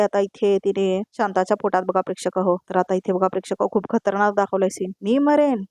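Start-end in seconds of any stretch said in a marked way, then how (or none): 5.0-5.6 mild acted disbelief, pitched high; incredulous retort. A narrator's reading. Priya retorting to Shanta during the attack, high incredulous question.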